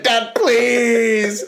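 high, drawn-out whine to Dad; mock crying plea. Grounded kid on badminton court begging dad, mock tearful. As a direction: beg as a tearful teen, hammed up. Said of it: "I was crying playing badminton".

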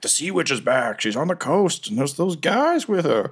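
high, rattled sailor voices; frightened survivors. Retold or imitated. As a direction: spread the rumor in a panic. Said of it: "survivors who are going around being like".

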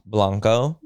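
"Blanco" said the Spanish way, open a; Spanish pronunciation. Shown as a demonstration. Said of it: "how you say blanco".

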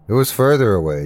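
ordinary delivery

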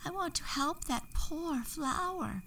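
small fairy begging to help the flower; fairy pleading. A narrator's reading. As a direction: beg softly as the little fairy. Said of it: "Daffodilia said".

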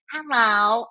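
"Hello." said high as a flat American greeting, rising; mock flat hello. Mocking her friends greeting Italian shopkeepers, a flat American hello. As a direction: mimic the tourist's English hello. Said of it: "make the effort".

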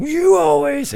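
high, loud imitation of an accusing colleague; mock-indignant shriek, imitating a touchy co-host; mock Brady outburst. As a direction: burst out in a hammed-up high voice. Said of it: "And Brady has to turn".

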